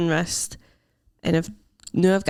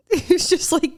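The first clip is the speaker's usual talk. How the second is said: laughing; 10 semitones higher than usual. Real, not acted. Laughing retelling partner.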